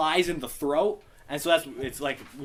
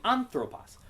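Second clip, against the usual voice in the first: ANthropos, pitch raised on "an"; pitch accent on first syllable; 6 semitones higher than usual. Shown as a demonstration. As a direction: lift the "an" of "anthropos", then drop. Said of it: "your pitch would go up".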